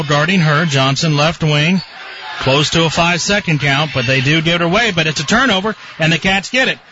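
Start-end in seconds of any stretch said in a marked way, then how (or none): 5.1-6.8 rising, fast, excited call; excited play-by-play. Real, not acted. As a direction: build the excitement on the run. Excited run by the Cats.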